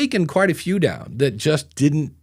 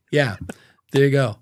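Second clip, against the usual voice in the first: usual speaking voice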